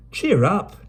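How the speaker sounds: high, bright-voiced cockney girl cheering; cheery Dido. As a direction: buck her friend up.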